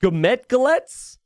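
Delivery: Gometgalets? tried out slowly and drawn out, pitched well up as a guess; trying out a name. Shown as a demonstration. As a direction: sound out Gometgalets? carefully and unsure. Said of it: "I don't know how to say your name".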